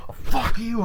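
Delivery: high, loud mock yell; acted angry outburst. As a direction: imitate swearing at someone in a fight. Acted quarrel.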